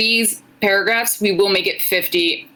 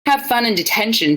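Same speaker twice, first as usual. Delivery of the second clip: plain reading voice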